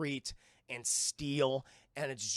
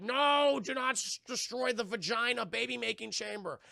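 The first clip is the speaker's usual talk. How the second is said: higher, loud opening "No"; pleading villain protest; 5 semitones higher, 3 dB louder than usual. Acted. Zod protesting his chamber's destruction, mock protest. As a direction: cry out the order, easing off after. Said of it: "Zod's like".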